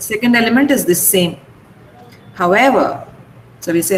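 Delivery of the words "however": however with EVER rising then falling; stress on EVER. Shown as a demonstration. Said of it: "the second element is stressed".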